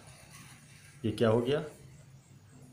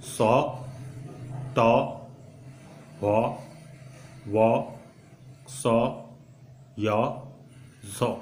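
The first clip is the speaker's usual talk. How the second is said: syllables spaced out with long pauses; unhurried, clearly separated syllables; slower drill; much slower than usual. Shown as a demonstration. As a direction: leave room after each for learners to repeat. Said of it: "थोड़ा सा और slow में पढ़ता हूँ".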